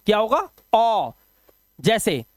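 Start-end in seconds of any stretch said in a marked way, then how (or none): 0.7-1.1 sustained single vowel "ओ"; AU vowel sound. Shown as a demonstration. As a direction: say "ओ" as one long rounded "aw". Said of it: "एयू का उच्चारण अभी ओ ही होगा".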